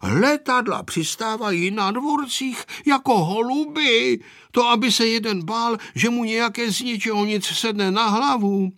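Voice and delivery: high-pitched marvelling chatter; wondering villagers' complaint; amazed neighbours. A narrator's reading. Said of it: "žasli sousedé".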